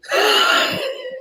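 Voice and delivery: high, shrieking laugh; burst of laughter. Real, not acted. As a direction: crack up helplessly at the line. Teacher cracks up at the line.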